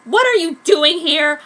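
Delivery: loud angry yell. A narrator's reading. Heroine yelling at smirking Minto, angry. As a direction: yell it at the rival. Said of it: "I yelled angrily".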